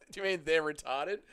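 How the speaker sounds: asked through a real laugh. Real, not acted. Streamer questions a chatter's odd wording, laughing. Amused by a chat message.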